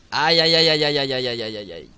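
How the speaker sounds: loud ouch ouch ouch, fading to a low mutter; string of aïe starting loud then dying away; pained ouch fading. Real, not acted. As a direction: wince repeatedly, trailing off. Character taking damage.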